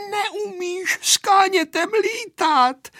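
put-on very high, drawn-out child's screech; squealed taunt, slow and high; screeching girl. A narrator's reading. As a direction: screech after him without real volume. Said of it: "vřískala za ním Aneška".

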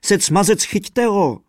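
hulákání, hlasitě a vysoko; bellowing fiddler. A narrator's reading. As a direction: shout it out to catch him. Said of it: "hulákal houslista".

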